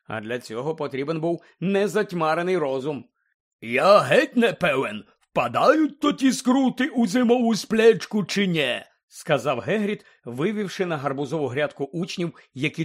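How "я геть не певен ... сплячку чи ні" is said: loud, slow character voice; Hagrid. A narrator's reading. Hagrid wonders aloud to students about hibernation, big slow voice. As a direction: boom it out slowly to a class.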